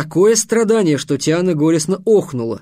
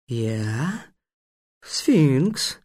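slow, questioning then grand self-introduction; hushed monster announcing itself; sphinx, self-important; 3 dB quieter than usual. Acted. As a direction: stretch out your own name with pride.

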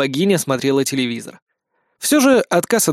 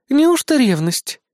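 slow, pitched-up coy question; teasing; 9 semitones higher, slower than usual. A narrator's reading. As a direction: tease her, drawing the words out. Queen teases her rival. (дразня, врастяжку)

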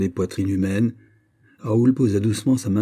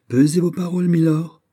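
haughty warning, high and loud; hauteur; 8 semitones higher, 5 dB louder than usual. A narrator's reading. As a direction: warn him from above. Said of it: "dit Raoul avec hauteur". (avertissement hautain, voix élevée)

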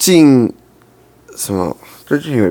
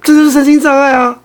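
cracking, strained protest; 13 semitones higher than usual. Real, not acted. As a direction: voice cracks high on 这. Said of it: "原来是你有破音".

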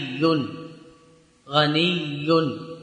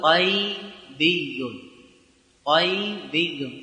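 drawn out syllable by syllable; slow demonstration; much slower than usual. Shown as a demonstration. Teacher modelling the nisba form for students, very slow. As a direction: spell the word out slowly for the class. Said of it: "فتقول".

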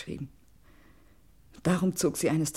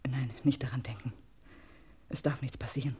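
anxious whisper; 4 semitones lower, 6 dB quieter than usual. Acted. Fearing for her husband's life.

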